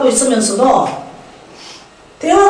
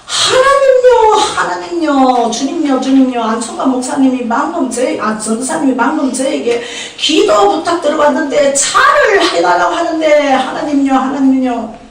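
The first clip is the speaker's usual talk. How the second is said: very emotional prayer; 3 semitones higher than usual. Retold or imitated. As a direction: cry out to God, overflowing with feeling. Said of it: "이것도 감정이 또 무지하게 많습니다". (감정이 넘치는 경상도식 부르짖는 기도)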